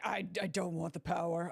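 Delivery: low, hesitant imitation; mock submissive lover. Streamer imagining Edgeworth at home, mock submissive and low. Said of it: "And then he's like".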